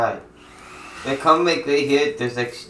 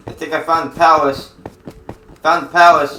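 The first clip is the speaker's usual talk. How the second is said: excited; 3 semitones higher, 8 dB louder than usual. Real, not acted. Kid excited finding palace in game.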